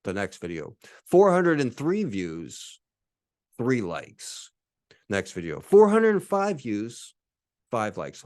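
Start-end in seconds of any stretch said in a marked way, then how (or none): none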